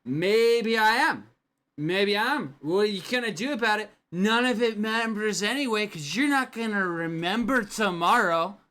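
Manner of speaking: put-on high, loud, sloppy drunk retort; defiant drunk. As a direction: sneer it back drunkenly. Drunk man answering back.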